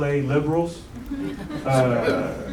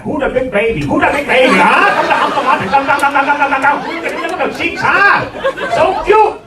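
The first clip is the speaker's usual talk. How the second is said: put-on high, loud cooing baby talk; excited baby talk; 8 semitones higher, 6 dB louder than usual. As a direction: gush over the baby's cheeks. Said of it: "I get really excited".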